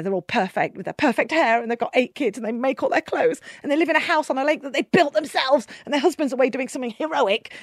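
normal talking voice

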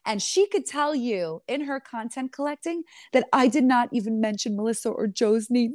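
normal talking voice

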